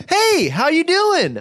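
very high, loud, drawn-out greeting; overfriendly drive-thru guy. Retold or imitated. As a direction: gush like an over-cheery order taker. Imitates overfriendly drive-thru employee.

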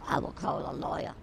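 very soft, low, barely voiced; hushed threat. Shopper threatening the other with a lawyer, hushed. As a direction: threaten it under your breath. Muttered comeback in acted quarrel.

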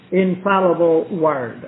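ordinary delivery

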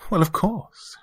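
put-on high, British-accented woman's reply; reply lightly in a high British voice. A narrator's reading. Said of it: "she had a British accent".

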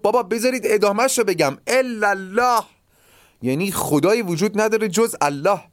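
high pleading protest while being beaten; retold plea. The beaten man pleads with his attackers to hear the rest, high pleading protest. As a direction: beg the crowd to let you finish, pitched high.

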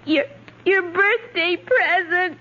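halting, tearful admission; crying. Acted. Child revealing father's present, crying. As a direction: stammer it out between sobs. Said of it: "please stop crying".

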